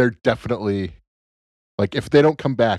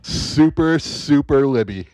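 mocking drawl; 6 semitones higher, 4 dB louder, slower than usual. Mocking label, slowed and far.

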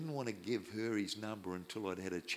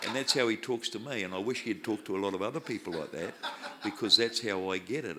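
laughing; 7 dB louder than usual. Real, not acted. Preacher laughing at his own joke, loud. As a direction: talk through real laughter. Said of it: "laughing while speaking".

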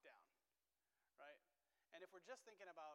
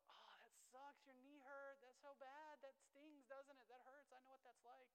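put-on higher, gentle comforting voice to a child who fell; empathetic to hurt child; 8 semitones higher, slower than usual. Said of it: "I have to be able to go".

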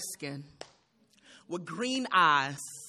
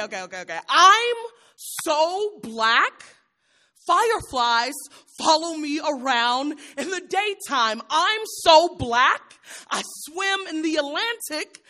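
higher, louder punchline voice like a roast comic; hammed-up stand-up comic; 7 semitones higher, 6 dB louder than usual. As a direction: sell every joke to the crowd, big and brash. Mock stand-up joke routine.

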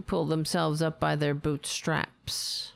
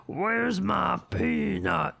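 squirrel voice; slower than usual. Retold or imitated. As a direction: drawl it out like a tough squirrel. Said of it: "That's what he sounded like".

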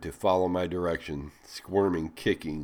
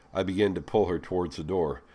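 usual speaking voice

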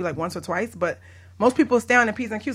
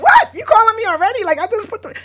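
high, loud disbelieving outburst; hammed-up astonishment; astonished; 14 semitones higher, 10 dB louder than usual. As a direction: retell it in loud, high amazement. Retelling her astonished reply to caller.

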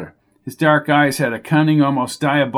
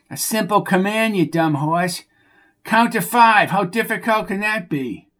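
higher, whiny voice of a dim stable hand; exasperated Bucky; 7 semitones higher than usual. Acted. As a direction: scold the horse like a fed-up oaf. Bucky voices frustration with horse.

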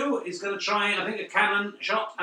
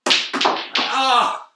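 surprised gasp; 5 semitones higher, 5 dB louder than usual. Real, not acted. In-off predicted, happens.

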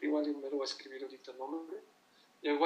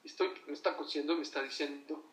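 normal talking voice

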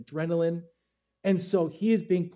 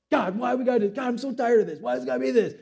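higher mock whine at God; whining; 5 semitones higher, faster than usual. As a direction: whine like a tired kid. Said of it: "Whining, whining, whining".

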